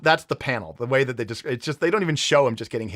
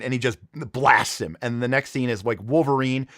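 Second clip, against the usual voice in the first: ordinary talk, nothing marked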